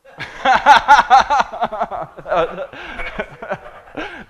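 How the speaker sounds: hearty real laugh; loud laugh. Real, not acted. Laughs at audience member's joke.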